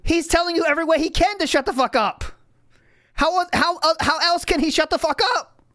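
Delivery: exasperated outburst. Real, not acted.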